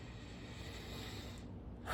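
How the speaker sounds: a long, soft, unvoiced release of breath; peaceful exhale. Shown as a demonstration. Showing viewers how to breathe in peace, soft sigh. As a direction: let the breath go slowly, shedding tension. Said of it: "Breathe in peace first".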